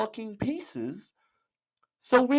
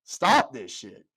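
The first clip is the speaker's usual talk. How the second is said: pretend protester; slower than usual. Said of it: "get outside and say".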